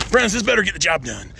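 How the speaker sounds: ordinary talk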